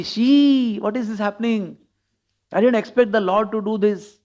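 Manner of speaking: taken aback, pitched well up; shocked disbelief. Acted. Devotees watching the Lord's antics, in shocked disbelief.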